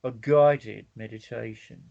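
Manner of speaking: slow, put-on Australian accent; drawn-out Australian vowels. Shown as a demonstration. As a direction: do an Australian meditation-guide voice. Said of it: "They always have an Australian accent".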